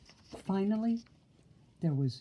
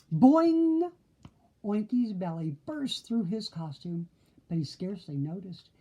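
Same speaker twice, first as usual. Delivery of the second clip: usual speaking voice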